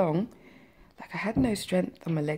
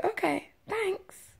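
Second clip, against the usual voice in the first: high, slow, blank acknowledgement; stunned and small, pitched way up; dazed, in shock; much slower than usual. Retold or imitated. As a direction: re-enact a dazed, automatic reply. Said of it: "I must have been in shock".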